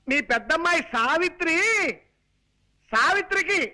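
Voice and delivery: high-pitched shouting for the hard of hearing; over-loud announcing. Acted. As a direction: bellow the news phrase by phrase. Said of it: "మామూలుగా చెప్పొచ్చు కదా". (గట్టిగా అరుస్తూ చెప్పడం)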